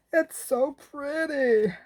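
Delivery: high, slow drawn-out American "It's so pretty"; American accent. Shown as a demonstration. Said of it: "That's a bit American".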